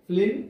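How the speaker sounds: ఫ్లింక్ broken before a separate final 'g'; sounds separated. Shown as a demonstration. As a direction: say ఫ్లింక్ as 'flin', pause, 'g'. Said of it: "sound విడివిడిగా వినపడుతుంది". (ఫ్లింక్ శబ్దాలు విడివిడిగా)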